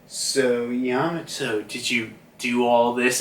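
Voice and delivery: sigh, then low, soft question; acted weary father. Kaido surveying knocked-out pirates, weary quiet questioning. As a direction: ask quietly after a long sigh.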